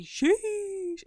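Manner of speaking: high sheesh. Acted. Sassy score reaction.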